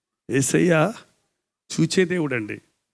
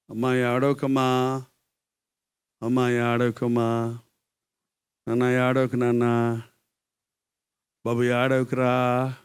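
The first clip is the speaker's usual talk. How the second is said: tender consoling; 4 semitones lower, much slower than usual. Acted. Preacher showing how to comfort someone weeping, tender consoling. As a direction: soothe them gently with long pauses. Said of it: "అలా అంటే ఎలా ఉంటుంది".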